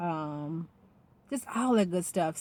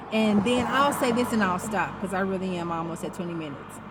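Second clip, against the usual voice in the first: ordinary talk, nothing marked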